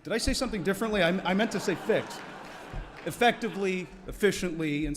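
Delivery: high, loud comic cover-up; loud recovery. Real, not acted. Speaker to audience after a slip of the tongue, loud and high.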